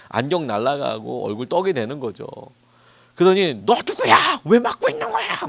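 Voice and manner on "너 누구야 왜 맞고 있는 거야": much higher, louder mock yell at the young man; high, loud retold shout; imitated yelling man. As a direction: play the angry homeless man shouting in confusion. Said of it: "막 이렇게 소리를 이렇게 지르다가".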